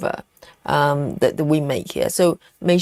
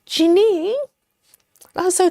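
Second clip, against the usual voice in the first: mock scolding; 10 semitones higher than usual. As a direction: call her name in mock outrage.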